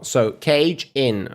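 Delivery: cage stressed, slow, then in; teaching stress. Shown as a demonstration. Streamer teaching chat, slow cage in. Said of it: "and then say in as well".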